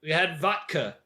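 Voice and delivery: "vodka" quoted as "VOT-ka"; his odd "vot-ka" way of saying "vodka"; imitated pronunciation. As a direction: mimic his "vodka" with a hard t. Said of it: "he pronounces it vodka".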